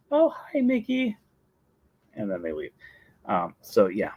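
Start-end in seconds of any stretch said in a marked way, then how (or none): 0.1-1.2 high, small mock greeting; gushing guest. Ordinary guest greeting Mickey briefly, high mock voice. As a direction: imitate a twee fan saying hello.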